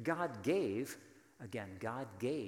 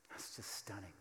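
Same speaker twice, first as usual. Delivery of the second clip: awed whisper; 4 semitones lower, 12 dB quieter than usual. Real, not acted. Awed aside after the point.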